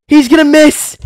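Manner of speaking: high tense call; excited call. Real, not acted. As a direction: call the miss breathlessly. Tense free throw moment.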